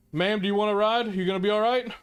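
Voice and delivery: high, quick, urgent offer; urgent offer of a ride. Acted. Gunman hurriedly offering the woman a ride out, high and fast. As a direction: rush to offer her a lift.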